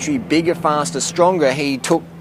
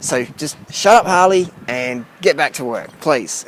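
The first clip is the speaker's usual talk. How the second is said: ordinary talk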